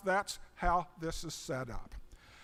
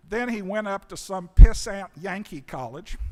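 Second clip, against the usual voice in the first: mock disdain; 11 dB louder than usual. Mock disdain for Yankee college.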